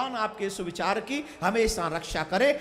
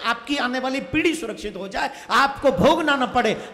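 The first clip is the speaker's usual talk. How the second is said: loud, raised sermon at full force; forceful preaching; 4 semitones higher, 9 dB louder than usual. Real, not acted. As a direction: thunder it to the crowd. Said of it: "जबरदस्त बोल रहा हूं". (श्रोताओं को ज़ोरदार प्रवचन, ऊँचे स्वर में)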